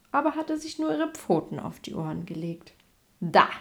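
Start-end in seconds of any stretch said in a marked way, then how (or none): 3.2-3.5 loud, demanding lion; big loud lion's cry; calling out. A narrator's reading. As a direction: blast the question out at the mouse. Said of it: "rief der Löwe".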